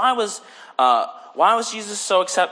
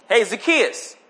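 raised-pitch hail; acted shout-out, mildly raised; calling out; 6 semitones higher, 3 dB louder, slower than usual. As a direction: call up to someone by name.

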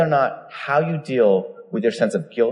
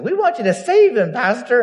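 put-on much higher, pleading old lady voice; retelling the old lady in the back asking the pastor to save him, in a much higher pleading voice; old lady's plea; 12 semitones higher, 3 dB louder than usual. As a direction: play a frail old lady begging the pastor. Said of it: "that's how it sounded".

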